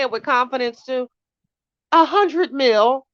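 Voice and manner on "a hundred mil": loud, slow, high confident call of the wrong name. Retold or imitated. Streamer to chat, mocking her own loud wrong guess. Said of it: "Girl, I was loud and wrong".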